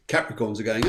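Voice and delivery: normal talking voice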